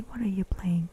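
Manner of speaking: ordinary delivery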